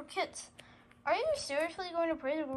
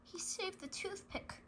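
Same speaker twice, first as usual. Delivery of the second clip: plain reading voice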